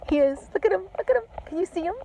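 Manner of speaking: high excited urging; real giddy excitement, pitched high. Real, not acted. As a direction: squeal for them to look at the owl. Excitedly pointing out the owl.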